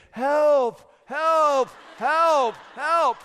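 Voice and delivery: high, loud mock wail for help; fake cry for help. Toddler fakes a fall, crying out dramatically. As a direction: ham up a toddler's fake distress call. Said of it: "you hear your son crying for help".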